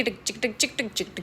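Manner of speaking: soft, quiet ticking sounds; tiptoe ticking. Shown as a demonstration. Quietly ticking out her steps toward the car. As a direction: tick out each little step softly. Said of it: "फिर मैं ऐसे चल रही थी".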